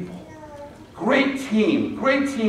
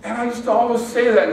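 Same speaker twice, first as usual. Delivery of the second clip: ordinary delivery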